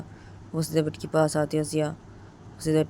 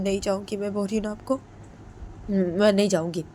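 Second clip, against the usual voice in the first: rising insistence, getting louder; stubborn refusal; 3 semitones higher, 6 dB louder than usual. Retold or imitated. Ritu insisting Jiya's mother leave, stubborn and rising. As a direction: dig in and order her out. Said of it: "तो रितु बोलती है".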